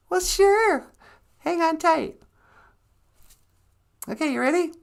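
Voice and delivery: very high, obliging put-on voice; sweetie character. Sweetie preparing to teleport someone, high chirpy voice. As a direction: agree eagerly in a squeaky high pitch.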